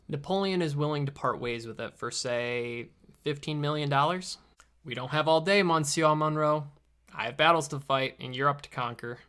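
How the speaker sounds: normal talking voice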